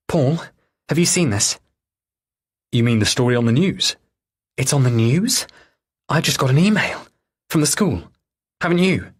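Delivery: alarmed wife. Acted. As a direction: call him in a panic. Julia alarmed on phone.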